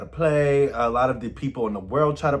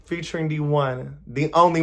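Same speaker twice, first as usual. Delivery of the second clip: ordinary delivery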